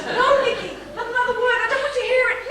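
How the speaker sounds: high, loud, laughing no; laughing protest. Acted. Wife begging Nicky to stop, laughing hard. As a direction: push the words out while laughing.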